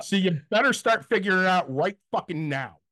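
high-pitched retold ultimatum; heated mock scolding; angry demand. Retold scolding of card agent.

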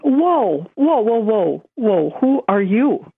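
high-pitched drawn-out whoas, retold; taken aback. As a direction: act out being startled by an unexpected stranger. Startled by a new inner voice.

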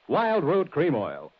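brand name drawn out slowly; slow slogan. Acted.

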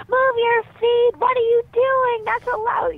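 very high, loud mock yelling; imitated kids' screaming. Kids yelling back at parents on the field, mock screaming. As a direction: scream it like kids mimicking their sideline parents. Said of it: "they started screaming".

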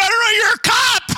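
high, loud disbelieving yell; hammed-up frantic shout; incredulous shout. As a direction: shout the obvious at him. Shouting at cop from car.

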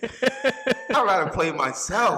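laughing out the words, slow and loud. Real, not acted. Laughing through answer.

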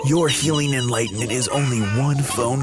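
low infomercial announcer voice; hard sell ad. Acted. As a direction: sell it like a cheesy late-night ad. Parody ad with music.

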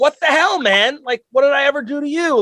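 high-pitched indignant dog voice; poor wronged dog. Acted. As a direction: protest like a wronged pet. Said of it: "that poor dog is like".

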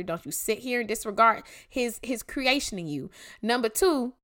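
ordinary talk

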